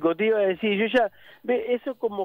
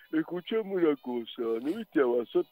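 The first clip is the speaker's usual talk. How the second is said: put-on boss voice; imitación del jefe. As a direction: call out as the mimicked boss. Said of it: "le había sacado la voz". (voz impostada de jefe)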